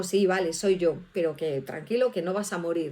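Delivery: low, easy, softening reassurance; calm them down, dropping low; casual reassurance. Acted. The Lord telling Gideon he will not die, casual reassurance.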